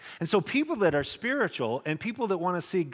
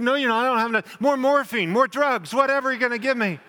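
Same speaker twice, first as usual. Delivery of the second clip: high, loud plea for more drugs; panicked demand; 8 semitones higher, 5 dB louder than usual. Retold or imitated. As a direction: insist frantically, rushing at the end. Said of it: "I said".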